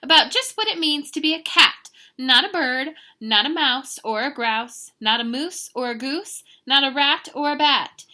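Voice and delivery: high, loud, emphatic mama lecture; acted parental scolding; lecturing mother. A narrator's reading. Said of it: "The lecture went on and on".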